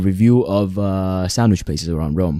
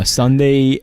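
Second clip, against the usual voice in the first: Sunday drawn out higher; emphatic; 6 semitones higher, 4 dB louder than usual. Real, not acted. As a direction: stretch Sunday out, insisting. Insisting on the deadline.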